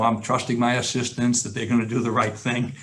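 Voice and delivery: ordinary delivery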